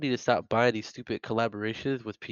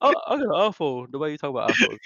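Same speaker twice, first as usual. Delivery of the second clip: higher mocking whiny voice; mocking imitation; 3 semitones higher, 3 dB louder, faster than usual. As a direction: imitate him to tease. Mocking friend's iPhone remark.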